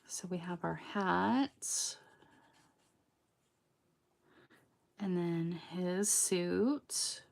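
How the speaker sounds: soft whisper while concentrating. Real, not acted. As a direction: breathe it out, focused on the work.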